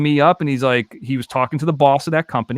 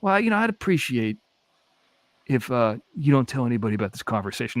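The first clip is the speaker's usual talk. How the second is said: imitating Seagal; 6 dB quieter than usual. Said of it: "he says like this".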